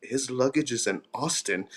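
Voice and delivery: whispering. Retold or imitated. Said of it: "He was whispering".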